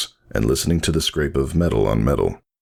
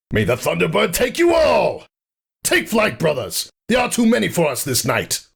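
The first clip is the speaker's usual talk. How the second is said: rally your brothers to flee; commanding shout. Acted. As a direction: call the retreat across the field. Retreat cry to troops.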